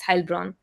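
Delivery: Heilbronn said as "HILE-bron"; Heilbronn, first syllable rhyming with "mile"; correct German pronunciation. Shown as a demonstration. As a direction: demonstrate Heilbronn with "ei" as in "eye". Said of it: "A usually pronounced".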